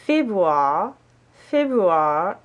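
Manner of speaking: Februar attempted the German way, ending "-oo-ah"; effortful German pronunciation. Shown as a demonstration. Learner struggling with her birth month, effortful German pronunciation. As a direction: labour over Februar, each try rising at the end. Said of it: "hard time pronouncing that one".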